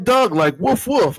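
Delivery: half-hearted Baltimore coworker explaining the dog. Retold or imitated. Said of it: "She's like".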